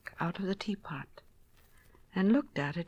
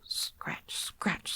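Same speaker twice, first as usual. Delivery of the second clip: unvoiced, hushed scratching sound; whispered scratch; 6 dB quieter than usual. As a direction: breathe the words like claws on wood. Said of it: "scuffled Simpkin".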